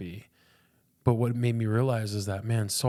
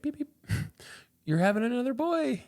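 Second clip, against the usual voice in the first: much higher, far-off nurse voice with beeping machine; imitating ultrasound lady. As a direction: chirp it out like the technician. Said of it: "she does the same thing".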